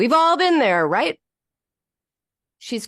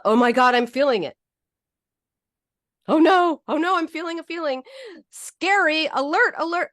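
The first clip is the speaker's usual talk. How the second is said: higher, hurried alarm at a feeling; mock panic; 6 semitones higher than usual. Speaker mocks dread of having a feeling, in mock panic. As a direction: sound a hammed-up inner alarm.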